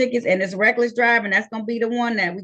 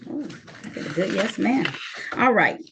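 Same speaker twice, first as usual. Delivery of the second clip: ordinary talk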